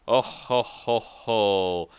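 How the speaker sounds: a hint of an evil Santa chuckle, dropping low; sinister mock ho ho ho; evil Santa laugh. A narrator's reading. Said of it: "like an evil Santa".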